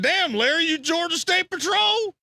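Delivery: high, slow, teasing put-on voice; mock accusing question. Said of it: "I said".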